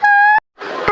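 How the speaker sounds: mourning his beautiful face, shrieking; screaming like a girl. Acted. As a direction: shriek and sob over the ruined face. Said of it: "लड़कियों की तरह क्यों चिल्ला रहा है".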